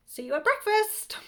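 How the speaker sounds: high, loud cheeky send-off; cheeky defiance. A narrator's reading. Child to cabbage after mum begs, cheeky. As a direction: toss it out high and unmoved.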